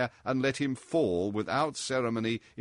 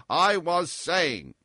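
high, loud, slow drawl out of laughter; chuckling sailor; 5 semitones higher, 5 dB louder, much slower than usual. A narrator's reading. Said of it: "chuckled Tarpaulin, without heeding the general excitation".

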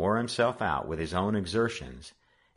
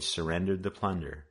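normal talking voice

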